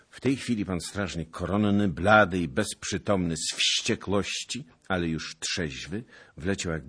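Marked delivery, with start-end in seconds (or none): none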